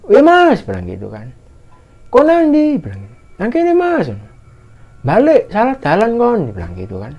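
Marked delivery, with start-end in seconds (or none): 0.1-0.6 high, loud holler back; imitated answering shout. Answering shout across the mountain. 2.1-2.8 high-pitched shouted question; imitated calling out. Calling to unseen hikers. 3.4-6.4 high, carrying holler; imitated calling out. Shouted exchange between separated hikers.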